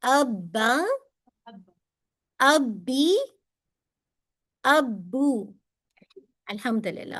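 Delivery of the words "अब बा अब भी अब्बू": ba with lips pressed firmly together; strong ba demo. Shown as a demonstration. Teacher demonstrating the firm ba to students. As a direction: press the lips hard and release the ba. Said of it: "अब मिल गए दोनों होंठ और मजबूती के साथ".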